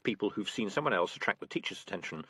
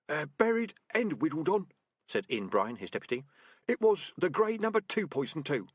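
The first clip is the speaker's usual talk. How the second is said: higher, thin worried report; anxious deputy rat; 6 semitones higher than usual. Acted. Deputy reporting to his sergeant, anxious. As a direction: report dutifully, a bit nervous.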